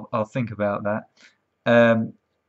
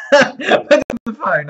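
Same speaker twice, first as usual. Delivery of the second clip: laughing; 9 semitones higher, 7 dB louder, slower than usual. Real, not acted. Laughing while telling.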